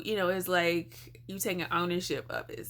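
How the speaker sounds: usual speaking voice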